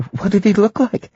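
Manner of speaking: high-pitched voice of the wife; wife's voice. A narrator's reading. Narrator voices the wife asking.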